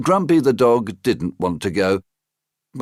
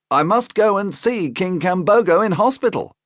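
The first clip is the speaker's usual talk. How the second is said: higher, eager uncle; a high, eager storybook uncle; high Uncle Jack voice; 12 semitones higher than usual. Acted. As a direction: pitch up into the friendly uncle.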